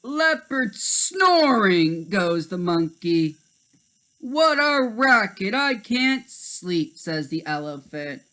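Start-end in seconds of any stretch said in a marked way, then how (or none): 0.0-2.1 high, slow monkey cry; high drawn-out monkey call. Acted. Monkey announces snoring. 4.2-7.0 high, loud grumpy complaint; high annoyed animal voice; annoyed elephant. Acted.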